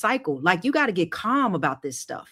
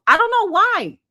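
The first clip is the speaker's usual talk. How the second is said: exasperated; 11 semitones higher, 6 dB louder than usual. Real, not acted. Exasperated rant at emotional viewers.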